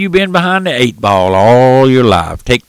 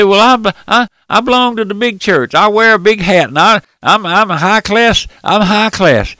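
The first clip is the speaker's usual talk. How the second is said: high-pitched mock boasting; imitated church member showing off; self-important churchgoer; 8 semitones higher, 3 dB louder than usual. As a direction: put on a proud objector, pitched well up. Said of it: "You say".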